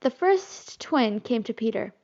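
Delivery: usual speaking voice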